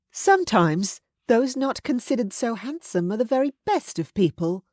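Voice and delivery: a hint of a level-headed friend; Charlotte. A narrator's reading. Charlotte voiced.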